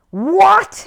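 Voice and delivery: high, loud startled "What?"; indignant surprise. A narrator's reading. Paddington outraged at the word jackpot. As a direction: bristle with disbelief. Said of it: "exclaimed Paddington hotly".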